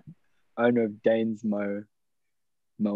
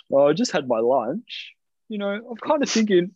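mock lazy tradie; 8 semitones higher than usual. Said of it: "he's like".

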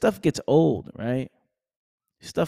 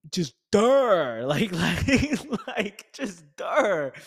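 mock dullard; 6 semitones higher, much slower than usual. Mocking a dull mind.

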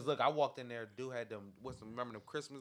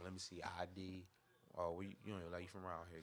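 low, quiet doorman checking people; 3 semitones lower, 9 dB quieter than usual. Retold or imitated. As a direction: screen them coolly, low and soft. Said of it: "he on some".

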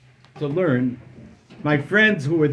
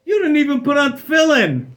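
mocking disbelief; 9 semitones higher, 6 dB louder than usual. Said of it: "I began laughing in his face".

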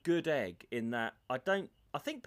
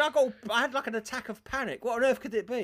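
higher, anxious version of himself; mock-worried patient asking the doctor, higher; worried younger self; 8 semitones higher than usual. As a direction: play his younger panicked self, higher. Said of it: "I said".

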